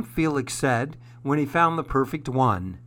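usual speaking voice

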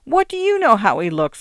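aggrieved narrated moan. A narrator's reading. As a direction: protest in pained exasperation. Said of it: "Parker moaned".

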